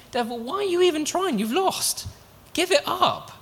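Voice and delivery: high, mocking call to the devil; mock taunt at the devil. Preacher taunting the defeated devil, high and mocking.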